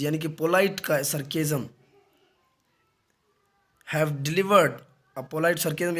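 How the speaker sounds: ordinary talk, nothing marked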